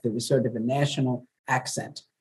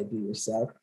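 softer, gentle rising Be yourself; Mister Rogers; 3 semitones lower, 9 dB quieter than usual. Retold or imitated. Host recalling Mister Rogers' gentle softer advice. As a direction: hush it kindly like a children's TV host. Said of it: "Mister Rogers used to say".

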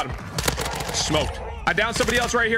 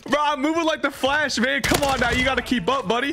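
cocky taunt; 8 semitones higher than usual. Real, not acted. Taunting an enemy mid-fight.

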